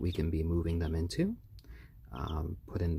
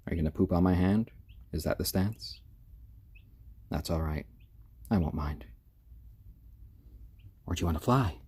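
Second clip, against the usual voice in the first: ordinary talk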